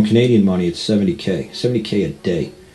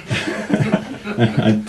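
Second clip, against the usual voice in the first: laugh; 4 semitones higher than usual. Real, not acted. Laughing while recalling a story.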